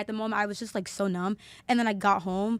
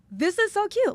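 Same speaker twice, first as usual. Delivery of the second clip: high, louder, delighted gush; retold happy delight; 12 semitones higher, 5 dB louder than usual. Recalling purple flowers after the funeral, happy delighted gush. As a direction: gush happily over a lovely sign. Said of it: "I felt so happy".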